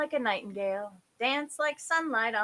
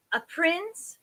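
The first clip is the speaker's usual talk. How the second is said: higher, rising tentative suggestion; hopeful; 8 semitones higher, 3 dB louder than usual. A narrator's reading. Queen prompting the fairy, hopeful. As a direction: offer it hopefully, pitched up. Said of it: "said the queen hopefully".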